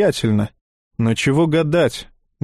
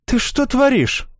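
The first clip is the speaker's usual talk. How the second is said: high startled cry; startled protest; surprised; 11 semitones higher than usual. A narrator's reading. Dasha flipped onto her back. (удивлённо)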